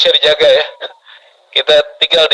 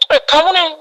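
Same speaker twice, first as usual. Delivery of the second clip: high-pitched mock alarm; scaremongering doctor; 10 semitones higher than usual. Doctor scaring a patient, imitated alarm. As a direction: startle the patient with a raised pitch. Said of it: "ada dokter yang suka nakut-nakutin".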